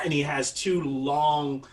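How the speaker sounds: ordinary talk, nothing marked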